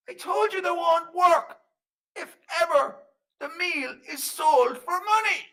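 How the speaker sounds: high, loud, slow rebuke; scolding fairy man. Acted. Fairy man reminds Flanagan of the rule, scolding loudly. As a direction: hammer out the rule in a high shout.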